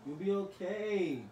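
slow soothing. Real, not acted. Comforting crying sister.

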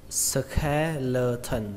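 SKELETON drawn out slowly as "ske-le-tần"; skeleton syllable by syllable. Shown as a demonstration. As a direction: break "skeleton" into slow, separate syllables. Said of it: "âm đầu tiên đó là âm khe". (đọc "skeleton" chậm, tách từng âm ske-lơ-thần)

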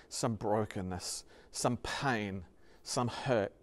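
low, soft, spaced-out phrases; hushed compassion. Real, not acted. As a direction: let each hurt land quietly. Preacher gently names congregation's suffering.

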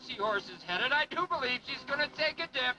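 booming showman announcer. Acted. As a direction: sell it to the crowd like a showman. Announcer introducing skiers.

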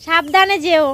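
loud, high goodbye; loud careful farewell; to someone hard of hearing. Real, not acted. Loud farewell to deaf grandmother.

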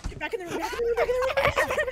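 high frantic shouting; panicked yelling. Real, not acted. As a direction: yell at them to get back. Fleeing monsters, calling teammates.

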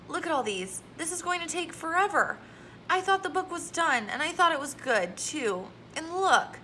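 put-on a girl whining over her editor's notes. Girl complaining to her teacher about editor notes, whining. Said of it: "Quit whining".

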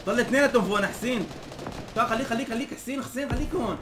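high, loud urging; urgent callout. Real, not acted. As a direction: hold the teammate in place. Urging teammate to stay put. (نداء عاجل بحماس)

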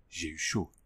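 low, soft and gloomy murmur; gloomy to himself. A narrator's reading. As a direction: mutter it darkly, relieved but grim. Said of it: "se dit lugubrement".

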